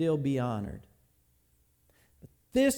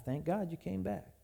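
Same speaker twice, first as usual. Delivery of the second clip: under her breath; 9 dB quieter than usual. Retold or imitated. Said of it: "under her breath".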